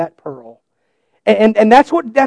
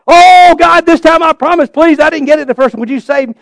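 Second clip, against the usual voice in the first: high, loud pleading to God; begging God in a rush; desperate plea; 9 semitones higher, 6 dB louder than usual. Retold or imitated. Kid at the altar begging God to save him again, high loud plea.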